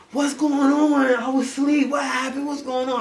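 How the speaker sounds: hammed-up boyfriend pretending to be sleepy; faking just woke up. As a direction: play dumb like you were asleep.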